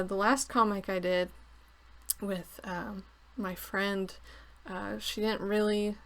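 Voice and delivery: ordinary talk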